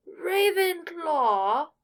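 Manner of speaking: very loud, high, drawn-out proclamation; announcing shout. Acted. Sorting hat announces Draco's house to the great hall, booming. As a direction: boom the house name to the hall.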